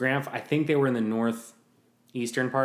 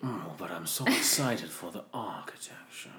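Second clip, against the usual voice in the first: softer put-on snobbish rapture; mock fancy enthusiast; 3 semitones lower, 5 dB quieter than usual. Mister Fancy begging to discuss architecture, mock refined. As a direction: gush quietly like an architecture snob. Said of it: "Mister Fancy".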